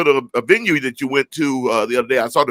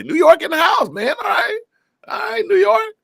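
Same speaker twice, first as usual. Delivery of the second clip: excited call. Real, not acted. Hyping New York viewers.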